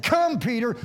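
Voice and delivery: high, loud summons on "Come"; commanding call. Acted. Jesus said come to Peter, a commanding call. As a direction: punch out the call. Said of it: "when Jesus said".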